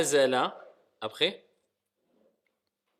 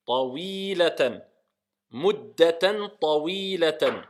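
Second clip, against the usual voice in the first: slow model reading; slower than usual. Shown as a demonstration. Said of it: "teacher models slowly".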